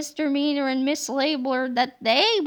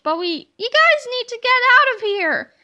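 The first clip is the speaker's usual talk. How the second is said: urgent interruption; 8 semitones higher, 6 dB louder than usual. Acted. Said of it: "Yesterday George interrupted".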